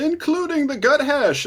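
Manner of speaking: high, proud announcement; boastful goblin hero. Acted. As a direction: top your boast in a high voice.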